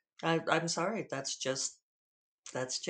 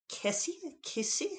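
attempted New Zealand accent, much higher and questioning; higher-pitched try at a New Zealand accent; Kiwi accent; 10 semitones higher than usual. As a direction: imitate a friend's Kiwi pronunciation, tentatively. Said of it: "with her Kiwi accent".

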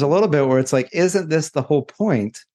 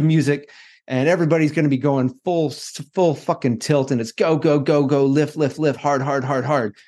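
fast pumped-up gym coach chant; hyped coach voice; 3 semitones higher than usual. Retold or imitated. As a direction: bark it like a CrossFit coach. Imitating hyped workout coach.

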